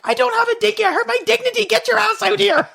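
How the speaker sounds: very high-pitched mock scolding yell; imitated exasperated mom. Mom sets child straight from manure pile, imitated exasperated yell. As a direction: holler it high, as an angry mom would. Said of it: "And she's like".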